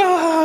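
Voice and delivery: high-pitched mock scream. Friends start running from the lioness, mock scream. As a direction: imitate a terrified shriek, not full volume.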